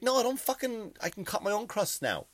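indignant grown man refusing help; mock indignant. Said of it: "I'm like".

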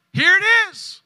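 high, loud shouted preaching; fiery preacher crescendo; full-force preaching. Acted. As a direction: hammer the truth home from the pulpit.

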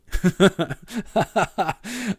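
bursts out laughing; real laugh at his own story. Real, not acted. Pastor laughing at his own story, laughing.

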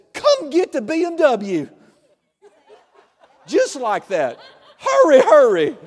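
hammed-up panic, deliberately overplayed; exaggerated panicked wife. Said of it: "No, she was calm".

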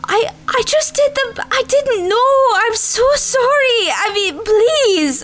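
a little gnome pleading while the cook screams; tearful pleading. Acted. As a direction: beg for mercy through tears. Gnome kitchen hand being screamed at.

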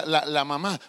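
ordinary talk, nothing marked

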